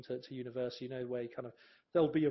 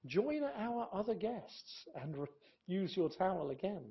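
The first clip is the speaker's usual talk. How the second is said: inviting sign voice; 5 semitones higher than usual. Shown as a demonstration. Reading out two hotel messages.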